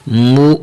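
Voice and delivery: مو cut off with a sharp glottal jolt; hamza jolt. Shown as a demonstration. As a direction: give مو a quick catch in the throat. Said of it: "میم کو حمزہ کے ساتھ جھٹکا دینا".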